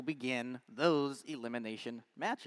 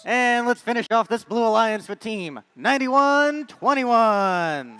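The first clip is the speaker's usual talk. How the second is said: arena announcer; 9 semitones higher, 12 dB louder than usual. Acted. Introduces teams to the arena.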